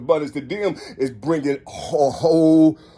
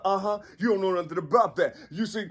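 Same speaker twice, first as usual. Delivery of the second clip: ordinary talk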